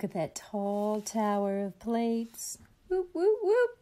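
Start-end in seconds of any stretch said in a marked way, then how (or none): 2.9-3.8 higher and higher whoops; rising whoop cries. A narrator's reading. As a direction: whoop up as plates wobble. Falling plates sound effect.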